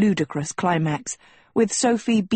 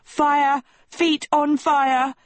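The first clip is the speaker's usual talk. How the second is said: high, drawn-out panicked yelp; pained yelp; 8 semitones higher, slower than usual. A narrator's reading. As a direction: yelp as if your feet burn. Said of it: "yelped Agatha".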